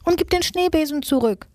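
high-pitched mock yell, little extra volume; storybook yell. A narrator's reading. Susi yells after the thief in the shed, high-pitched. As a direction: cry out a demand in a high character voice. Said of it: "schrie Susi hinterher".

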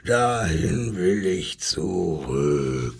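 low and slow, a lion who wants home; longing lion. Acted. Lion tells the zookeeper he wants to go back, low and slow. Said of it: "schrie der Löwe".

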